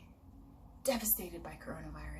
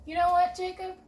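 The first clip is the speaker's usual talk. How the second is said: much higher and louder, bright call; cheery offer; 11 semitones higher, 9 dB louder than usual. Acted. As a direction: call out in delight. Israel cheerfully offers the coat.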